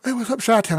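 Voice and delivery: "Hey, what's up, Chi-Town?" called out loud and high; greeting a viewer he just recognized, loud and high; excited greeting. Real, not acted. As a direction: shout it high and loud, thrilled to spot a friend. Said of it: "I just realized that was you".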